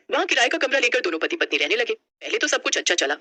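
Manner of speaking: usual speaking voice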